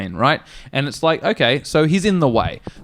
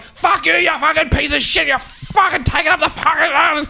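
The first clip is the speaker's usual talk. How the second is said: high shouting tirade, swearing; mock road-rage yell from an angry driver; angry abuse; 12 semitones higher than usual. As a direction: scream at the driver ahead. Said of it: "This guy's like".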